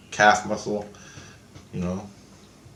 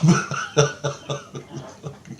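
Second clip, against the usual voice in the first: amused burst of laughter; breathy, unforced laughing fit; real laugh; 5 semitones higher than usual. Real, not acted. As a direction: laugh at your own silly memory. Laughs at own dream memory.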